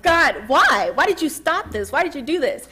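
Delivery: loud, high, demanding why; angry plea to God. Retold or imitated. Alone after throwing up the pills, angrily demanding why. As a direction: raise the voice at God in anger. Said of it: "I was mad".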